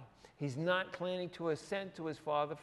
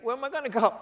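incredulous Peter; 9 semitones higher, 9 dB louder, much faster than usual. Retold or imitated. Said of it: "He's like".

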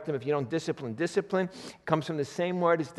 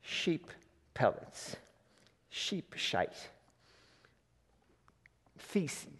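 muttered crude aside; 9 dB quieter, much slower than usual. Real, not acted.

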